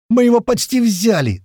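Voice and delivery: very high, loud cry; shouted protest. A narrator's reading. Fighter objecting to a retreat order, shouted protest. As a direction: protest over the radio. Radio protest amid gunfight.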